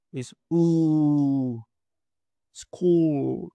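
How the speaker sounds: "School" with lips rounded, long u. Shown as a demonstration. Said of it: "Este sonido existe en el español". ("School" con U larga y labios redondeados)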